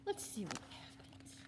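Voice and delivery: whisper. Real, not acted.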